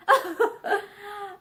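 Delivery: a short, gruff laugh in the throat. A narrator's reading. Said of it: "He laughed gruffly".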